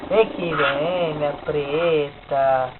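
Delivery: slow, hushed sweet-talk to a dog; soft coaxing. Real, not acted. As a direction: coax gently and slowly.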